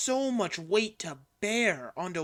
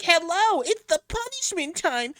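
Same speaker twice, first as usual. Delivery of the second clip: much higher and louder, slow maniac squeal; super high-pitched; 12 semitones higher, 10 dB louder, slower than usual. Shown as a demonstration. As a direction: crank the pitch way up like a crazy mascot. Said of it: "you could just go super high-pitched and be like".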